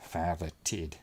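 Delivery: a lightly put-on, poor New Zealand accent; hint of fake New Zealand accent on the vowels. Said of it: "'cause it's the New Zealand version".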